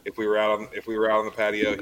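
ordinary talk

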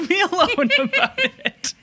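mock-indignant through real giggles; laughing protest. Real, not acted. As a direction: protest while cracking up. Laughing mock indignation at being teased.

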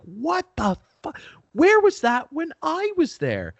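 high-pitched, outraged squeal of envy; indignant outburst. Retold or imitated. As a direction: squeak it out like a cheated kid. Childhood envy outburst, quoted self.

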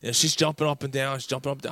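ordinary talk, nothing marked

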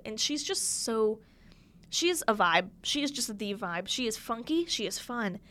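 usual speaking voice

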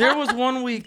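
high laughing start of the line. Real, not acted. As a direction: begin it while cracking up. Restarting while laughing.

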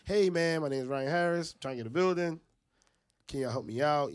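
pitch after rejections. Retold or imitated. Fundraiser to the fifth car after hearing no, pleading. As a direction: push the worn-down plea out loud. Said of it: "and they keep saying no".

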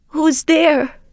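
put-on high, frail old woman; old grandmother voice. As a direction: call out like a frail granny. Grandmother answers the knock.

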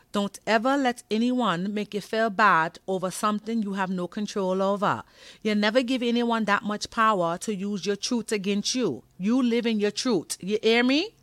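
put-on high, loud Caribbean-accented lecture. Imitating the Caribbean mother giving the girl advice in a Caribbean accent. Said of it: "making fun of Caribbean accent".

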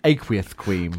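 aqueous said as aqueouth; lisp. Shown as a demonstration. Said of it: "it's just good with a lisp".

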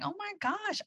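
high, soft mock squeal; retold high-pitched astonishment; delighted surprise. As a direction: softly squeal in happy disbelief.

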